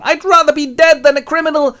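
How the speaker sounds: loud, high wailed protest; melodramatic breakdown. Acted. Orphan refuses the burglary, breaking down loudly. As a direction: wail like a child breaking down. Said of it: "He breaks down on the lawn".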